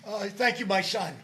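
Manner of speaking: loud blessing, breaking into laughter; priestly pronouncement through giggles; laughing. Acted. Pope gives joking penance.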